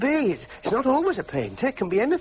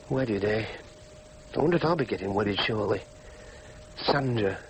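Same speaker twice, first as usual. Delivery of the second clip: low, halting inner monologue; weary musing; 10 semitones lower, 4 dB quieter than usual. Acted. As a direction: mull it over glumly to yourself, pausing often. Alone, musing over wedding photo.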